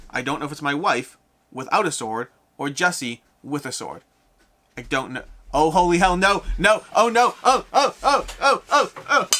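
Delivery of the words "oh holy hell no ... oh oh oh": loud, high string of oh-nos; alarmed outcry. Real, not acted. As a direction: cry out higher and louder in alarm. Reacts in alarm to the swordplay.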